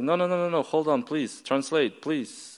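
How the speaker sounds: high, rapid mock-panicked protest; flustered pleading. Boy begs officer for a translator, flustered pleading.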